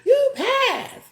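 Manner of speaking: very high, squealing mimicry of fawning fans; mocking the supporters. Supporters cheering her lie detector result, mocking squeal. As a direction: gush in a mock falsetto, far above your own voice. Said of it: "y'all all was".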